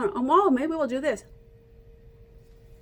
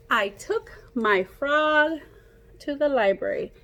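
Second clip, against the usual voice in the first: storybook reading; 3 semitones higher, 4 dB louder than usual. Acted. Reading aloud to children.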